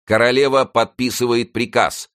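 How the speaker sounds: high, loud proclamation; announcing shout. A narrator's reading. Coadjutor shouts news to the crowd, loud. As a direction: call it out to the crowd. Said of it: "крикнул коадьютор".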